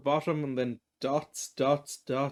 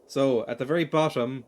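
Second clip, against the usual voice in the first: normal talking voice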